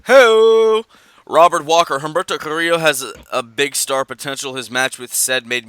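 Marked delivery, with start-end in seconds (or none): 0.1-0.8 loud, high whoop of delight; excited whoop. Real, not acted. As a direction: let out a sudden excited Ho.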